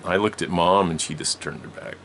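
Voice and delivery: ordinary talk, nothing marked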